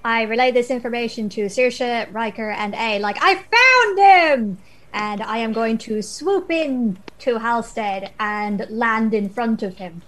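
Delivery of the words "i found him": excited call. Acted. Calling out finding lost boy.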